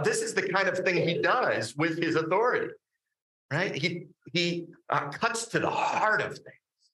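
normal talking voice

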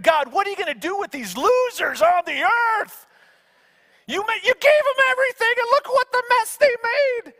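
high pitched heavenly chatter, half shouted; angels complaining. Retold or imitated. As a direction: ham up the exasperated angels. Angels voiced in retold story.

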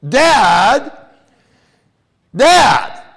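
put-on very high, loud little-girl yell; shrieked protest at Dad; indignant child shout. Little daughter objects to dad walking in.

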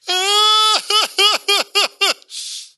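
high, loud real laugh breaking out; burst of laughter. Real, not acted. Father laughing at son's couch crash, loud high laugh.

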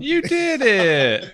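high, slow, drawn-out praise; baby talk praise. Acted. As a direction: cheer on a toddler.

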